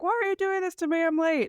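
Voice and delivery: high, quick whine of protest; imitating protesting husband. Late husband protesting the hug to his wife, mock high-pitched complaint. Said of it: "he's like".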